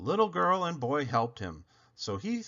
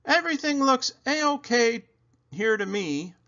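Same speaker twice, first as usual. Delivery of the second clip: policeman; 11 semitones higher, 4 dB louder than usual. A narrator's reading. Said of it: "The policeman said".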